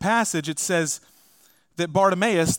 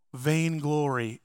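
ordinary talk, nothing marked

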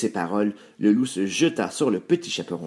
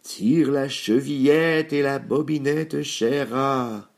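wolf faking a sweet granny voice, raised and slow; slow, higher put-on wolf voice; wolf softening his voice; 5 semitones higher, slower than usual. A narrator's reading. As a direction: raise the pitch and coax slowly, as a disguised wolf. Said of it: "le loup en adoucissant sa voix".

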